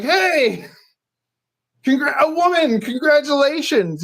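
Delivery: high, cheery re-enacted greeting; friendly congratulating.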